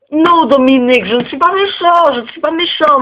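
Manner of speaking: teacher pleading with pupil Dominique, high and insistent. Acted. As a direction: pitch up to plead your innocence.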